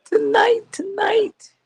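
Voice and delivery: high gleeful singsong; bubbling over with joy; excited. Real, not acted. Host cheering the doctor's grandbaby news, high and gleeful.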